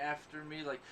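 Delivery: ordinary talk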